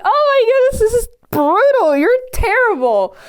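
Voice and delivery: high-pitched, appalled outburst; shocked reaction. Retold or imitated. Viewer reacting to brothers left to drown, high shocked disbelief. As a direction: play up horrified disbelief.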